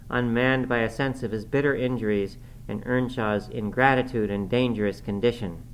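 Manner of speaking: ordinary delivery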